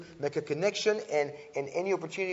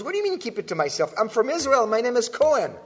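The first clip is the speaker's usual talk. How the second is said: higher, louder mock-indignant retort; indignant comeback; 8 semitones higher, 6 dB louder, faster than usual. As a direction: fire back as the offended TV guest. Retold comeback to interviewer.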